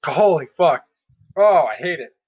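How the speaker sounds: talking behind hand while chewing; muffled speech through food; mouth full, chewing. Real, not acted. Seen 0: Bitten cake held; hand covers mouth while talking, until 3.2s.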